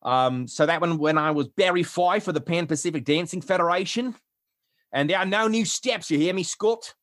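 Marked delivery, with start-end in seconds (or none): 1.5-6.9 put-on Barry Fife with a broad Australian accent; higher-pitched Australian dance official. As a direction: lay down the law in Australian. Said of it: "Australian, I, I learned to do".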